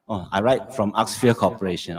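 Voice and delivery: normal talking voice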